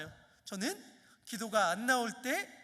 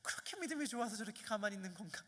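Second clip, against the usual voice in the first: quick, softer mocking aside; wry private thought; 2 semitones lower, 10 dB quieter, faster than usual. Preacher's teasing thought about silent youth, quick and softer. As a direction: muse a little teasingly. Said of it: "이런 생각이 들긴 합니다".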